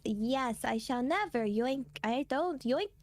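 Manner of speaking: usual speaking voice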